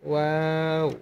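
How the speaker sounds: ওয়াও said with rounded lips, drawn out; slow rounded-lip ওয়াও; Arabic letter demo. Shown as a demonstration. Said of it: "দুই ঠোঁট গোল করে ওয়া হরফটি উচ্চারণ করবেন".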